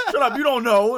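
high, loud crying shout; tearful yell. Retold or imitated. Crying teen yelling at stranger, high tearful shout. Starting to cry, yelling back.

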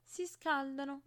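a lightly voiced irritating squeak; shrill annoying little voice. A narrator's reading. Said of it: "con vocetta acuta e fastidiosa". (vocetta acuta e fastidiosa)

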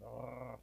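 low, soft imitated growl; mock dog growl, low; little dog growl. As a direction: growl like an old porch dog. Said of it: "little growl".

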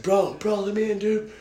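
high-pitched yell without the volume; high, held-back mock yell; mock screaming. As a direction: imitate screaming for a buddy. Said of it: "I'm screaming his fucking name".

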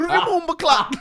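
very high, loud hammed-up patois shout; mock patois.

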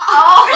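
very loud, high cry of outrage; real shocked outburst. Real, not acted. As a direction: react loudly to a terrible punchline. Shocked reaction to story's punchline.

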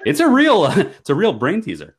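high loud laugh-through words. Real, not acted. Co-host laughing at the silly soup question, loud and high. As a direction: crack up while saying it.

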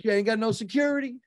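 high-pitched, amazed imitation; mock a stranger's disbelief; big guy. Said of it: "some big guy".

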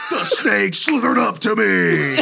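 high, slow, laughing and shouting; laughing storytelling. Pretend. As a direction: tell it big while cracking up.

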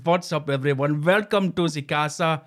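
lightly voiced YouTube host greeting; botched Hitler vlogger. Hitler greeting his YouTube viewers, botched accent.

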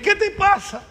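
high, loud imitating of puzzled friends; mock concerned classmates. Friends asking the crying priest what's wrong, high mock voice. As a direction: echo their worried question. Everyone asks the weeping priest.

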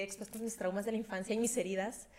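ordinary delivery

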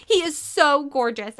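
high, loud gushing; bursting out. A narrator's reading. Girl confesses her crush to her sister, gushing loudly. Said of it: "Ashley burst out".

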